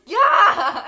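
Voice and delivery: very loud, high mock yell; imitating upset husband. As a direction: explode in mock exasperation. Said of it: "he's so upset with her".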